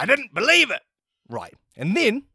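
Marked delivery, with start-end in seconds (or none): 0.0-0.8 high, dismissive director voice; mocking director. Director Mario rejecting the take, high and dismissive. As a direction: brush off the take. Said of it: "It's like".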